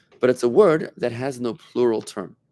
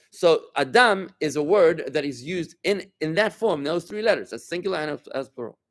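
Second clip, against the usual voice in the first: usual speaking voice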